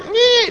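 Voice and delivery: high-pitched mock bray; imitated donkey noise. Preacher imitates a hungry donkey, high mock bray. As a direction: squeal a rough attempt at a donkey. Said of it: "Whatever the donkey noise is".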